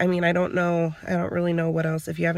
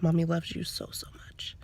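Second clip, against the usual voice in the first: tearful, trailing into a whisper; crying; 2 semitones higher, 6 dB quieter than usual. Real, not acted. Mother to her girls, whispered through tears. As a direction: mom breaks down as she speaks to her daughters. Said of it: "and I'm crying".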